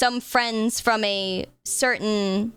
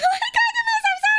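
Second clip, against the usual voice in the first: squealed high through real laughter; laughing apology; slower than usual. Real, not acted. Laughs at own panic apology.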